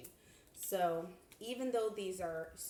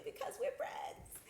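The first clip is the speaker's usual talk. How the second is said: high, slow playful whisper; 14 semitones higher, 4 dB quieter than usual. Acted. To viewer friends about being thrifty, playful high whisper. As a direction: confide it to a friend.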